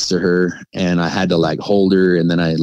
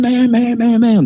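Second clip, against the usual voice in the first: knocking sounds; 4 dB louder than usual. Retold or imitated. Banging again, loud.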